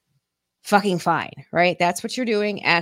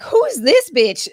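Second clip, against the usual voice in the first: shocked; 8 semitones higher, 8 dB louder, slower than usual. Retold or imitated. Shocked at friend's change.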